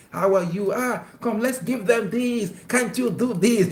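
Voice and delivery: mock a couple faking happiness; fake cheerful host. Couple pretending to be happy before visitors, hammed-up cheer. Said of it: "where we act like film".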